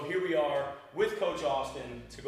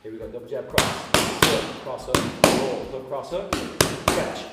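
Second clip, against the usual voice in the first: calling combos; 2 semitones higher, 5 dB louder than usual. Shown as a demonstration. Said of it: "any cues that I have, you guys are gonna hear".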